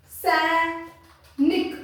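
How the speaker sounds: सैनिक very slowly, long open ai in सै, short निक; drawn-out syllables. Shown as a demonstration. Teacher dictating a Hindi word to pupils, slow drawn-out सैनिक. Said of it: "अगर मैं आपको बोलूं".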